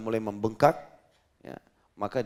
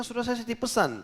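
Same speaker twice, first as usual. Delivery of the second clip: upset wife; 11 semitones higher, 4 dB louder than usual. Retold or imitated. Wife scolds husband, alarmed.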